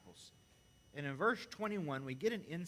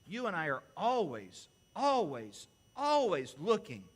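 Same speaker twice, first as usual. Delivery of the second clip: louder on each always; building emphasis; 7 dB louder than usual. Acted. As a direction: hammer the repeated always. Preaching emphasis.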